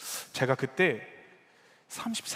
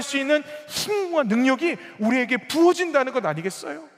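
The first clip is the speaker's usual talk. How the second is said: loud, high, driving sermon delivery; raised-voice pulpit exhortation; impassioned preaching; 9 semitones higher, 12 dB louder than usual. Real, not acted. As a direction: preach at full force, building to a peak. Preacher at full force.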